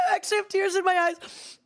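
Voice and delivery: giggling through the words, laughing till tears; real helpless laughter, barely getting words out. Real, not acted. Laughing fit over yogurt scraping.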